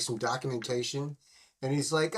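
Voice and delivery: usual speaking voice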